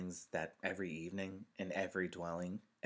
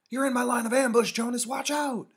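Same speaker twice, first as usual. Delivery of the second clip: put-on high, fast, loud boyish yell; excited playground shout; shouted warning; 16 dB louder than usual. A narrator's reading. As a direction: urgently holler to a friend in a game. Boy warns friend mid war game.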